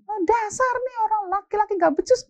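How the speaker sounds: high-pitched mock rant; fed-up wife. Mama scorning her husband, hammed-up high-pitched exasperation. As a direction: grumble shrilly about a useless man.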